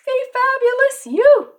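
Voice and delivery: high and loud, throw "fabulous" out big; exuberant sign-off. Real, not acted. Host cheering viewers goodbye, high and loud. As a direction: cheer the audience off with a burst of glee.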